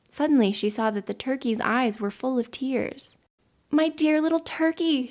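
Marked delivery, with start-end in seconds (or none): 3.7-5.1 high, tender outcry; concerned exclamation. A narrator's reading. Mother seeing her child in tears, concerned exclamation. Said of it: "she exclaimed".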